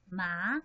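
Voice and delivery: MA rising at the end; second tone. Shown as a demonstration. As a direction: lift MA upward like a question. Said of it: "look at the example of tone".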